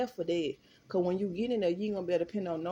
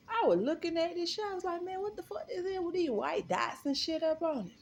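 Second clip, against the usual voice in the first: high disgusted disbelief; 12 semitones higher than usual. Retold or imitated. Inspecting the ham sandwich.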